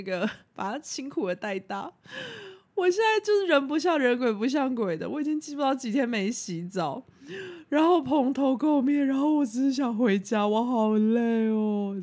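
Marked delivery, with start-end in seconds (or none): 2.8-12.0 self-pitying whine. Retold or imitated. Self-pity about her dirty, tired state.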